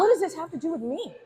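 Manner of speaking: high mock-indignant voice. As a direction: act offended, pitch up. Teasing reply to apology.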